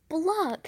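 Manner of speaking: loud high disgusted bluck, tongue out. Acted. Little brother tasting sour gum, loud disgusted bluck. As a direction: spit out a yucky bluck. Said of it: "sticking out his tongue".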